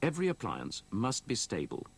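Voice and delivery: ordinary talk, nothing marked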